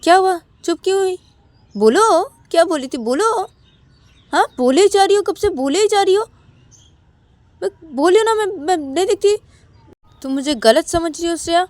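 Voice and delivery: high, loud, challenging outburst; very angry. A narrator's reading. Shruti confronting Shreya who has gone silent, very angry. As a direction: confront them, demanding they speak up. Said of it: "श्रुति बहुत ही गुस्सा हो गई है".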